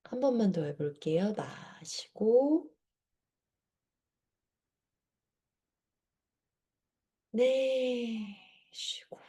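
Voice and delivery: soft, slow breathing guide; guided breathing cues. Shown as a demonstration. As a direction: cue each breath gently and slowly. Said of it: "사 초 한번 해볼게요".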